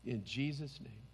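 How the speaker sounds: hushed worshipful closing prayer. Acted. Pastor closes in prayer.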